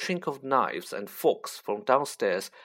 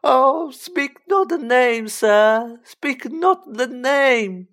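high, loud, drawn-out elf moan; anguished house-elf pleading at high pitch; 12 semitones higher, 9 dB louder, much slower than usual. Acted. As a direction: wail it slowly in dread of the name. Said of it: "clapped his hands over his bat ears and moaned".

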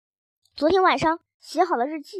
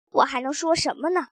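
ordinary delivery, nothing marked

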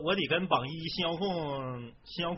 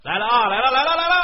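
much higher, much louder hype call; hype shout; 9 semitones higher, 15 dB louder than usual. Pretend. Streamer hypes viewers before the song, shouting high. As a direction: fire up the stream audience with a shout.

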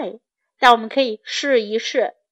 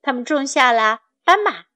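ordinary delivery

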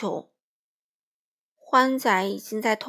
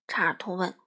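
usual speaking voice

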